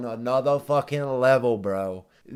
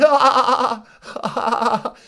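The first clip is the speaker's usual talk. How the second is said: loud, high imitation of a cackling laugh; mock laughter; 9 semitones higher, 6 dB louder than usual. Mocking the Ritz owner laughing it off, loud high fake laugh. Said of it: "He said".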